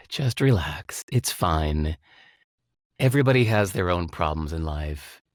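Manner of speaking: sigh, then low, easy reassurance; calming self-talk. Acted. Driver talking himself down after being cut off, relaxed low reassurance. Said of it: "It will make you go".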